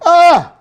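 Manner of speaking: very high loud ah; sharp anguished cry. Real, not acted. Host cut off by grief, very high anguished cry. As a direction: cry out in dismay. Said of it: "anguished cry".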